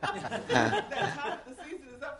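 loud, laughing through the words; big burst of laughter as you speak. Real, not acted. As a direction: talk while cracking up. Laughs after quoting a prayer.

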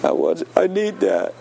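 high-pitched tearful whimper, imitated; mock crying. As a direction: play a big man breaking into tears, comically. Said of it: "Tiny started crying".

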